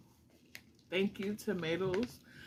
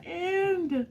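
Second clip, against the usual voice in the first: voice breaking; 12 semitones higher, 4 dB louder than usual. Real, not acted. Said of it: "I'm literally shaking".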